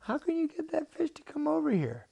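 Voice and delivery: put-on very high, squeaky child's question; incredulous boy. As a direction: squeak it out in disbelief. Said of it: "And Jordan's like".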